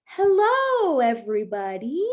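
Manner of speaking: high, loud furry monster greeting; big bright hello as a puppet monster; a cheery Grover calling out hello. Acted. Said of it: "Grover starts by saying".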